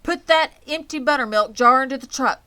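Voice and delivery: loud, high firm command. A narrator's reading. Father commands his son to load the jar. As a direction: order the boy sharply. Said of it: "commanded Mister Arable".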